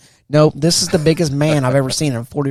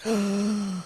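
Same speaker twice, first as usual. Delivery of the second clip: soft high mock gasp; frightened gasp; 7 semitones higher, 7 dB quieter than usual. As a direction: draw breath in pretend fear. Recruit confronted about his father.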